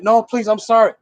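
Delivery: high mock begging; pleading. Man apologizing to fed-up fiancée, high pleading voice. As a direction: imitate a man begging forgiveness.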